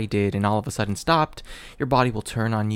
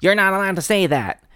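high, loud outraged scold; shrill-voiced scolding stranger; scolding busybody; 9 semitones higher, 6 dB louder than usual. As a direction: pop out and scold like a self-appointed word police. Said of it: "some horrid human ready to jump".

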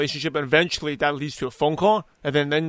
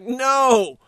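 high, drawn-out "no"; emphatic refusal; 8 semitones higher, 4 dB louder than usual. Retold or imitated. Host answering listeners who ask about pitching too soon, emphatic refusal. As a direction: exclaim it as a mock-exasperated rebuff.

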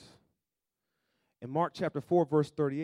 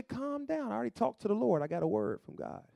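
calm reassurance; 8 semitones higher than usual. Retold or imitated. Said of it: "They say".

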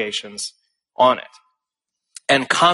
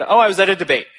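breezy, offhand reply; casually cheerful retelling of his answer; cheerful and calm; 5 semitones higher, much faster than usual. Retold or imitated. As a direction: toss it off lightly and calmly. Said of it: "just cheerfully, calmly, I said".

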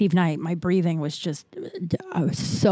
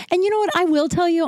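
excited; 10 semitones higher, faster than usual. Real, not acted. Hyped-up reveal to the audience.

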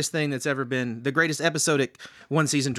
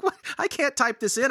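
higher mock-bewildered voice; confused friend; 12 semitones higher than usual. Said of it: "You're like".

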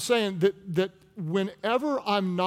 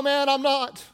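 raised, higher voice of protest; emphatic denial; 9 semitones higher, 5 dB louder, slower than usual. Acted. Peter protesting to his accuser, emphatic denial. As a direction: deny it loudly, pitched up.

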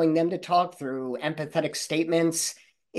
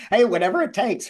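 laughing; 7 semitones higher, 4 dB louder than usual. Real, not acted. Host laughs at the sign joke.

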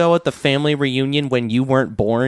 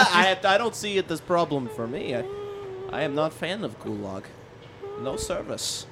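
mock Russian accent; 2 semitones higher, 10 dB quieter than usual. Russian agent reply.

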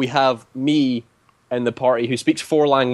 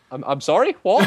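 baffled; 6 semitones higher than usual. Retold or imitated. Baffled players react.

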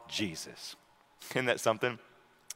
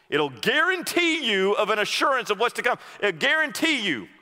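high, loud fervent preaching; preaching at full force; 12 semitones higher, 4 dB louder, faster than usual. Real, not acted. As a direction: drive it home to the congregation. Preaching climax.

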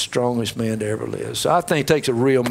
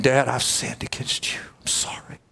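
quiet, ending in a whisper; humble confession; 4 dB quieter than usual. Retold or imitated. Prodigal son to his father, hushed and contrite. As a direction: confess softly like a ashamed son.

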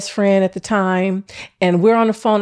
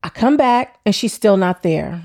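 usual speaking voice

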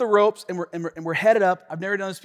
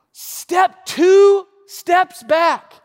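very slow, high, loud order; firm command; 12 semitones higher, 8 dB louder, much slower than usual. Retold or imitated. Guide giving a firm slow order across the bridge. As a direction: spell it out slowly like a stern order. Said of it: "He looked back and said".

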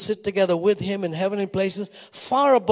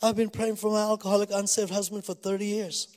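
imitated crying; 3 semitones higher, 5 dB quieter than usual. Crying woman answers the pastor about her husband, imitated tearfulness. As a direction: lightly voice a weeping woman. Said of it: "And she's crying".

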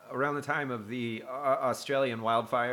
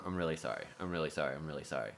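lower, soft apologetic murmur; a touch of a grieving Grim Reaper, quieter and lower; mock sorrowful reaper; 8 semitones lower, 10 dB quieter, much faster than usual. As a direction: apologize softly, again and again. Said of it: "he's going".

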